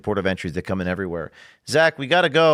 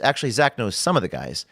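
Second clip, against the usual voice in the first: normal talking voice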